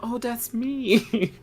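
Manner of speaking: high, slow oh, that's me; mock realization. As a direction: friend echoing the dawning moment, high and slow. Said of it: "echoing the realization".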